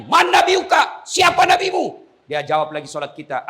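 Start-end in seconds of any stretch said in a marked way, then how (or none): 0.0-2.1 high, loud shout; thundering interrogation. Acted. Angel questioning the dead in the grave, thundering shout. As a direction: demand answers like a stern grave angel.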